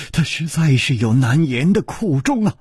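ordinary delivery

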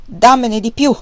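loud rough order; strange harsh voice. A narrator's reading. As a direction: bark it like a stranger's voice. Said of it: "con quella strana voce che pareva quella di un altro".

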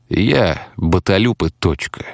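ordinary delivery, nothing marked

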